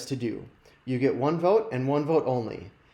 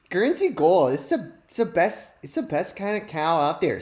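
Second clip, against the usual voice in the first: put-on higher Minnesota accent with Guernsey Gold punched loud; mock the ad lady's Minnesota sales pitch; impersonating the milk ad lady; 3 semitones higher, 3 dB louder than usual. Host goes back to mocking the ad lady, Minnesota accent. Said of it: "my impersonation of that lady from the video".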